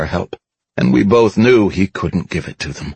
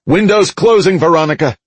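high, raised shout over the comm; urgent shout; 11 semitones higher, 4 dB louder than usual. A narrator's reading. Narrator shouts to Veronica over the comm, urgent shout. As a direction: call out urgently, pitched well up. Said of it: "I shouted over the comm".

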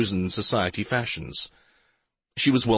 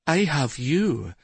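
silky purr; 5 semitones higher, slower than usual. A narrator's reading. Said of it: "the voice answered in a silky purr".

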